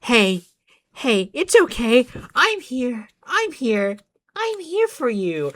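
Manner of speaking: a hint of a tender Pomni; mock comforting. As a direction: coo it like soothing a friend. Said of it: "Then she's like".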